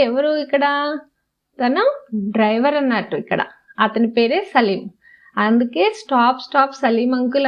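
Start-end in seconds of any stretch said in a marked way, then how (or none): none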